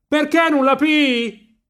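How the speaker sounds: very high, slow holler; full-lung shout. A narrator's reading. Marcello urges Riccetto to catch the swallow, full-lung high shout. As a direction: bellow the question across the water. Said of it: "gridava Marcello con quanto fiato aveva in gola".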